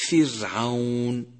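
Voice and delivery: فرعون slow, with a light thin R; thin R demo. Shown as a demonstration. Teacher showing students a thin R, slowly. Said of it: "ولاحظ الترقيق في قولك".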